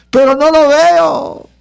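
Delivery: a lightly voiced worried churchgoer, drawn out; doubting believer. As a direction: voice the doubter who can't see God.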